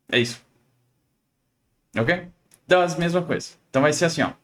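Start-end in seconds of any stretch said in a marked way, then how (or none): none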